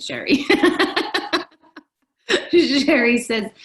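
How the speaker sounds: real burst of high, loud laughter; laughing. Real, not acted. Host teasing a listener who commented, laughing. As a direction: crack up, then talk through the laugh. Joking with listener Sherry about wine.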